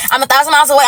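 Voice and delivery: usual speaking voice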